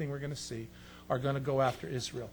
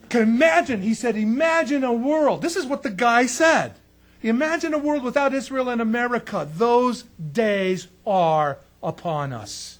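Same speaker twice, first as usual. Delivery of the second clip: higher, louder pulpit preaching; preaching at full force; 8 semitones higher, 11 dB louder than usual. Acted. Preacher warning his congregation about Israel's enemies, preaching loud and high. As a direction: drive it home to the congregation.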